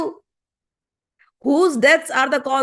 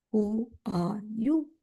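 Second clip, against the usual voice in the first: slow, soft, drawn-out "who are you?"; slow probing question; 3 semitones lower, 8 dB quieter, much slower than usual. Acted. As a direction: stretch "who are you?" slowly and quietly, like a searching challenge. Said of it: "tell me precisely".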